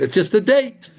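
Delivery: high, rising "It's just a date" as if it's nothing; mock dismissive. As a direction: toss it off like a trifle. Mock dismissal, then corrected.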